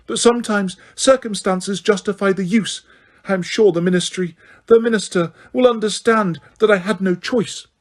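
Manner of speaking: high, tense pleading-threat; agitated, justifying herself; restless Umbridge. A narrator's reading. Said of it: "still moving restlessly".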